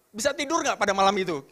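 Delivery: normal talking voice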